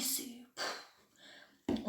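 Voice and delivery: voiceless, deflated whisper; mock defeated whisper. Youtuber pretending to be crushed by a comment, in a whisper. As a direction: sigh it out as if crushed, but playing.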